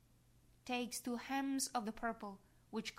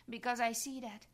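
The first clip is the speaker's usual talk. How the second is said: usual speaking voice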